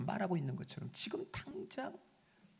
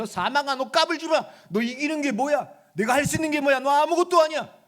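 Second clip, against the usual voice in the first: higher, louder, scornful scolding shout; furious rebuke; 9 semitones higher, 12 dB louder than usual. Retold or imitated. Preacher voicing a scolding rebuke of death, shouting. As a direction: tell death off, belittle it. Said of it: "호통치듯이 얘기하는 거예요".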